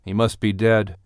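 ordinary delivery, nothing marked

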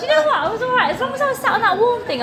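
high, giggly and fast; laughing. Real, not acted. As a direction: laugh through it. Laughing about painkillers.

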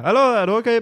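high mock call of the date. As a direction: call through the door, pitched high.

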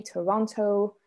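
ordinary delivery

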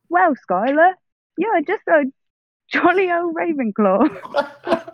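playful posh-English J K Rowling impression; high British accent. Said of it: "a little bit more high pitched".

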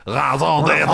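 cartoon Cajun accent, loud and high; mock New Orleans French. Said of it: "It sounds like a New Orleans person speaking French".

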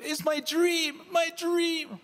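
put-on very high, loud kid squeal; excited child. Dad voicing his son realizing it's Legoland, squealing high. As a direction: squeal with a kid's sudden joy. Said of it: "You're so excited".

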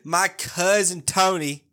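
put-on slow, loud Jersey wiseguy accent; mock Jersey accent. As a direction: lean into a mock Jersey drawl for "cousin Tony". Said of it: "we got real Southern".

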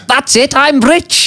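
high, slow, dreamy voice of sudden riches; mock naive sponsor. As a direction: float it out like you just won. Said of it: "you get a sponsor and you go".

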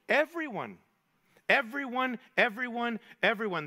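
lifted "Everyone" with each repetition falling in the end; repeated emphasis. Shown as a demonstration. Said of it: "Three times Paul says everyone".

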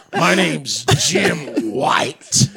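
very slow, drawn-out self-introduction; hammed-up, stretched-out name reveal; invented bigot character. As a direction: introduce yourself with mock gravity, word by word. Invented racist character introduces himself.

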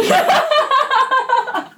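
shrieking burst of laughter; loud high laugh. Real, not acted. Dancer cracking up at her coworker's quote, loud high laugh. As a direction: howl with laughter.

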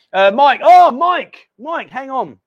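high, loud eager call to a viewer; excited calling out. Real, not acted. Host calls out to viewer Mike, excited and loud. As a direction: grab Mike's attention, delighted.

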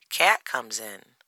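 plain reading voice

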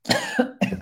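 coughing fit. Real, not acted. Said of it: "Sorry, I'm choking".

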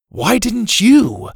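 high, loud slow retort; group retort. A narrator's reading. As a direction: throw it back at him. Globetrotters retort together.

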